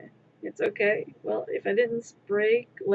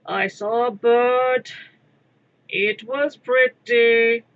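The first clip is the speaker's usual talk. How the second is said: slow, louder, dopey delivery; dim cartoon character; 8 dB louder, slower than usual. Quoting DBZ Abridged, a slow, dopey loud line. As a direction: say it like a dim-witted cartoon thug. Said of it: "quoting D B Z abridged".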